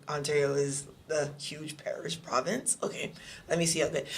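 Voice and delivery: low and muted, doubting; quiet skeptical reply. Retold or imitated. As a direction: mutter back, unconvinced. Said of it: "I'll be like".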